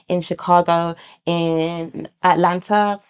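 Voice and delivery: usual speaking voice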